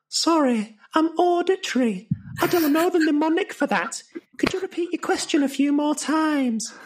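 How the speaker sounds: high, floaty student voice; a dreamy girl with her eyes still closed. Acted.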